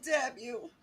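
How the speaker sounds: ordinary talk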